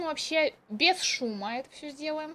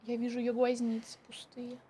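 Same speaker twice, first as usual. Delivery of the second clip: hushed whisper; 4 semitones lower, 9 dB quieter than usual. Real, not acted. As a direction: whisper so it won't hear. Hiding from monster.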